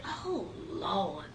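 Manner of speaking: very soft, low sigh of dismay; hushed, retold weary reaction; imitating dismayed cousin. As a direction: let it sink almost to nothing.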